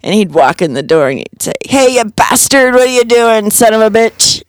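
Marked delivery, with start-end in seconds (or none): 1.7-4.4 lightly acted rowdy hello, pitched up; mock-insult a pal in greeting; boisterous greeting. Big friend walking into dad's kitchen, mock-gruff boisterous greeting.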